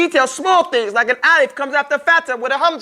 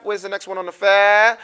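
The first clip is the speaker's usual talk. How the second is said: ordinary talk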